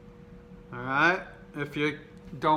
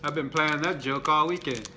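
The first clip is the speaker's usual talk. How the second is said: ordinary delivery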